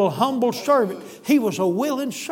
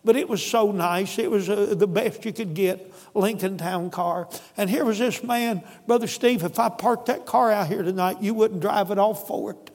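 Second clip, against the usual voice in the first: ordinary delivery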